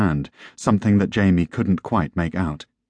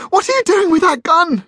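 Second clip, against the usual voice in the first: very high, loud screamed question; horrified scream; 6 dB louder than usual. A narrator's reading. Wife to husband holding a gun, horrified scream. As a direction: scream in disbelief and panic. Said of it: "she screamed".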